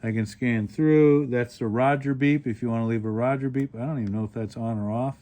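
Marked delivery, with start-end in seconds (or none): none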